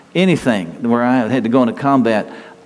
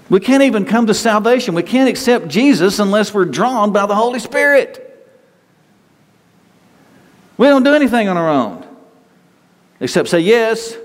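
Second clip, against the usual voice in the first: full-force preaching; 9 semitones higher than usual. Acted. Preacher urging the church to accept God's love, impassioned. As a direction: drive the message home to the congregation.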